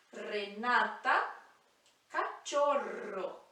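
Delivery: Renata, cachorro, slow, with a rolled r; trilled R. Shown as a demonstration. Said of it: "R marcado".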